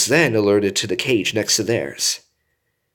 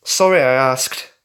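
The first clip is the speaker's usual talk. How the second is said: sarcastic; 5 semitones higher, 7 dB louder than usual. A narrator's reading. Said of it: "Carlos spoke again sarcastically".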